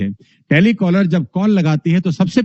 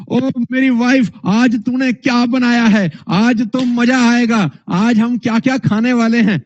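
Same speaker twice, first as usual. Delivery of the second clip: trainer's hype tone; 6 semitones higher than usual. Shown as a demonstration. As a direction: pump up the wife like a seminar crowd. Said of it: "घर जाके भी ऐसी बात बोलूं". (Seminar वाला जोशीला लहजा)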